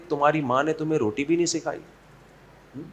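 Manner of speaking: very soft mock-sweet mother-in-law taunt; quiet barb in a sugary tone; sweetly venomous. Shown as a demonstration. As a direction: needle her gently, hushed and honeyed. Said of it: "صرف بڑے میٹھے لہجے میں بول لے".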